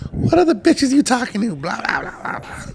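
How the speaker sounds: put-on high, suspicious accusing girlfriend; shrill jealous partner voice. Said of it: "they're gonna be like".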